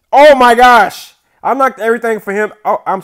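loud, high exasperated cry; dismayed yell. Real, not acted. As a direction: yell in real dismay at your blunder. Dismay at own blunder.

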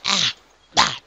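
usual speaking voice

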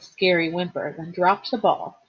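ordinary delivery, nothing marked

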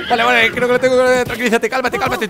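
high, urgent babble; flustered. Real, not acted. Rider trying to calm his new horse, flustered. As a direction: gabble it while hanging on.

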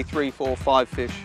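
usual speaking voice

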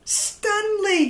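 high, loud happy shout of a name. Acted. The shoal greeting Stanley, high happy shout. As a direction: call him home with joy. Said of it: "his friends all shouted".

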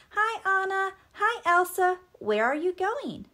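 put-on high, loud childlike greeting; cheery Olaf. A narrator's reading. Olaf calls to the departing sisters, cheery. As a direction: call out eagerly in a high voice.

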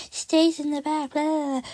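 high, dopey self-mockery; self-mocking repeat. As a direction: parrot your own words goofily. Mocking own phrase just said.

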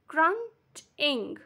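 Crunching as crunch, pause, ing; two slow beats in Crunching; split into syllables. Shown as a demonstration. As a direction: break Crunching apart. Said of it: "two syllables".

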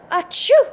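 high, very loud acted sneeze; imitated sneeze. A narrator's reading. Nelly soaked to the skin, loud pretend sneeze. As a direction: burst it out on the second syllable. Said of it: "Nelly began sneezing".

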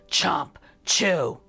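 ordinary delivery

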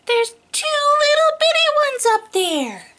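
tiny voice. Acted. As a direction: squeak it up for the little ones. Pointing out tiny baby dinosaurs.

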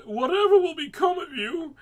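high, loud wailing mother; moaning mother. A narrator's reading. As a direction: wail it like a fretful mom. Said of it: "she moaned".

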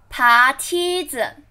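usual speaking voice

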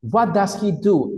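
careful classroom English; model question. Shown as a demonstration. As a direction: spell out "What does he do?" word by word for learners. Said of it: "Esta pregunta hay que tener cuidado".

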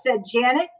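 ordinary delivery, nothing marked

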